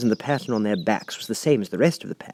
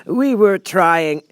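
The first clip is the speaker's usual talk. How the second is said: a lightly higher humble plea; humble gardener; 11 semitones higher, 7 dB louder, much slower than usual. A narrator's reading. The kneeling gardener to the Queen, a humble plea. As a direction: kneel and plead meekly. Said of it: "in a very humble tone".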